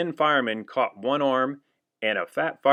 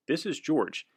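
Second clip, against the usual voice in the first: ordinary delivery, nothing marked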